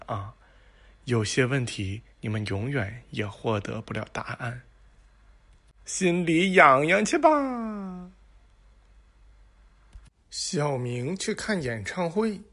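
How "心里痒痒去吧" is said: slow, high teasing jab. Real, not acted. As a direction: drag it out to tease them. Teasing the audience.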